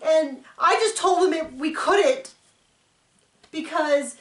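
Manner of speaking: lightly voiced, smug retelling; imitating Robin. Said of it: "then she says".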